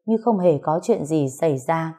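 usual speaking voice

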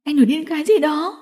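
high-pitched indignant woman; angry scolding. Acted. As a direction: scold him in distress. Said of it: "Diệu Tinh tức giận quát".

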